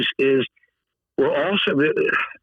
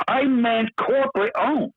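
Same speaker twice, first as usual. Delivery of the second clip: defiant insistence; 10 semitones higher than usual. Retold or imitated. Guest insisting to the CEO, high and pointed. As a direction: dig in and challenge him. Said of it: "I said corporate owned".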